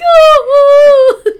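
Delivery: high, loud mock-crying whimper; hammed-up blubbering oh; mock wail. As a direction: imitate him whining in distress. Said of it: "and he's like".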